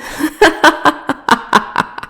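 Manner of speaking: breathy, scornful villain's cackle; mocking airy laugh.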